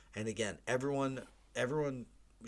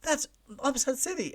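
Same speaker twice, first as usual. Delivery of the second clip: much higher, louder mock astonishment; retold amazement; 7 dB louder than usual. Telling his family the upset stunned him, hammed-up amazement. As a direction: ham up falling for the prank. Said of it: "And I was like".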